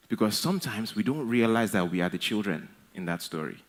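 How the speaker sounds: normal talking voice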